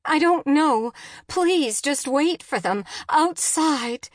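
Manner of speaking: high, loud, desperate plea; frantic wife pleading; begging. A narrator's reading. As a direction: beg him urgently, pitch jumping up. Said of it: "Jen begged".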